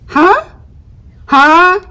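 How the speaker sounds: high, loud challenging huh; indignant huh. Acted. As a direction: demand an answer, squaring up. Indignant demand.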